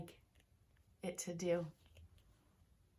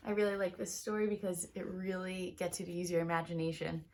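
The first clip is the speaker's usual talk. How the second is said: ordinary delivery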